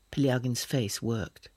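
usual speaking voice

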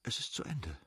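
soft, halting and happy; quiet, tender relief. Acted. Kitty tells Levin after the birth, soft and tender. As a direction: breathe it out, exhausted and glad. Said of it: "stockende, lebendige und liebevolle, glückliche Stimme sprach still".

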